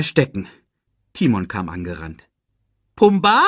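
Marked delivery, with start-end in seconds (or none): 2.9-3.4 high, loud questioning call; puzzled question. A narrator's reading. Timon finds his friend's kicking legs, puzzled call. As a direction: call the name as a startled question. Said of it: "fragte er".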